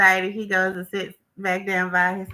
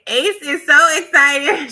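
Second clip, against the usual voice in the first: excited; 6 semitones higher, 8 dB louder than usual. Real, not acted. Ace excited after Shelly safe.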